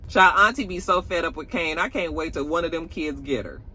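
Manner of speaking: usual speaking voice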